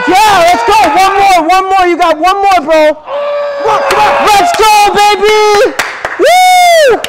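loud high mock gym cheering; hype gym coach. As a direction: yell like a spotter at the gym. Cheering friend on massage chair.

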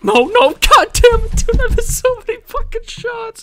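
very high, loud wail of frustration; frustrated outburst. Real, not acted. Gamer who just missed every shot, frustrated yell. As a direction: yell in disbelief at your own misses. Just lost after missing shots.

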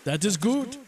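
high-pitched U-boat captain calling back; yelling back. A narrator's reading. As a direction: call back across the water. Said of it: "the U-boat captain yelled back".

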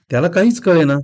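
ordinary delivery

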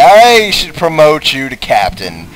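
excited thanks. Acted. Voicing grateful character.

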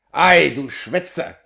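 slow, loud, furious "Ei, du Schwätzer"; angry outburst. A narrator's reading. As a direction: spit the insult out slowly. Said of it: "rief Pinas erbost".

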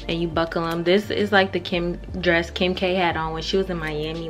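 usual speaking voice

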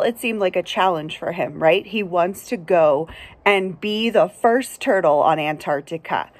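usual speaking voice

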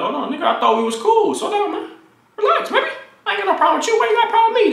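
squeaky, pleading mock voice; high-pitched protest. Acted protest in a retold confrontation.

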